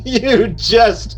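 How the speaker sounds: shrill burst of laughter; high cackle. Acted. Fortunato laughing at the trowel, high cackle. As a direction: laugh out loud at the joke.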